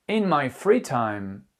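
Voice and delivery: slow teacher demo of "free"; slow model phrase. Shown as a demonstration. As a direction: say it slowly, stressing free. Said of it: "teacher demo, slow model phrase". (lentement, en appuyant sur « free »)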